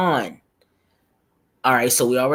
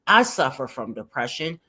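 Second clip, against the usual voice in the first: higher, pointed reading aloud; emphatic comment read-out; 6 semitones higher than usual. Acted. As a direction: read it out like a scolding lecture. Reads a viewer comment aloud.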